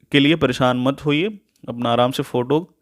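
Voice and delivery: ordinary talk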